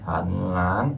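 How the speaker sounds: "hẳn lán" with a low hẳn, then lán sweeping up; rising tone. Shown as a demonstration. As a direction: let "lán" climb slowly from a low "hẳn". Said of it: "Nó đi lên này".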